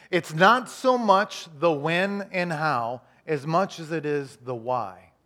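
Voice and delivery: very slow, stretched, stressing so. Shown as a demonstration. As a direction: drag it out so it sinks in. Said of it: "Let me say it one more time".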